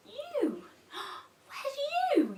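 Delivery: a gasping, wide-eyed storytime question; mock surprise. Shown as a demonstration. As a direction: act amazed for toddlers. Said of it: "wait for the children to pull it down".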